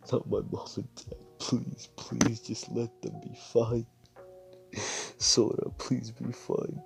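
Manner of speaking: weep and plead at once; a broken, whispery crying voice; sobbing plea. Acted. Crying over dead parents.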